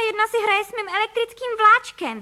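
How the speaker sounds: loud, very high outcry; outraged youngster's yell; high-pitched shout. A narrator's reading. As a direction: cry out in high, loud protest. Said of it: "vykřikl Filip".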